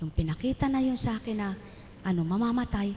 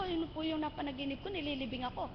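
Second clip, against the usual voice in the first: normal talking voice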